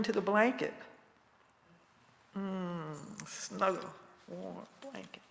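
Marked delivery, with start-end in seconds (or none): 2.4-5.2 soft, cozy murmur; warm and tender. Acted. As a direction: settle in softly, like snuggling. Snuggling into grandmother blanket.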